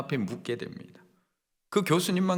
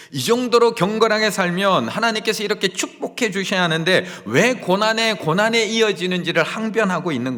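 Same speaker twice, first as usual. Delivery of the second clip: louder preaching, driving each phrase; impassioned preaching; 5 semitones higher, 6 dB louder than usual. Acted. Preacher urging the congregation, in loud impassioned preaching. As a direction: press the point hard from the pulpit.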